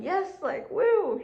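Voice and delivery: high, loud joyful whoop; excited cheer. Retold or imitated. Inner voice cheering over a job callback, high excited whoop. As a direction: let out a gleeful woo. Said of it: "my brain was going".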